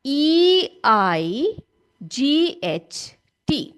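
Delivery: very slow letter-by-letter dictation, E I जी H T; dictating E I जी H T letter by letter to the class; slow spelling aloud. Shown as a demonstration. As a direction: spell E I जी H T out slowly while writing. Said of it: "देखो यहां पर मैं लिख रही हूं".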